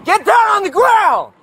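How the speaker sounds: shouting. Real, not acted. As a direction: bark it at him. Repeated order, shouted.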